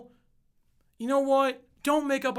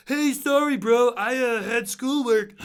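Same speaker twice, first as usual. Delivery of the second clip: sheepish excuse; 2 semitones higher, 4 dB louder than usual. Retold or imitated. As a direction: play the lame excuse-maker. Mocking own flimsy excuse.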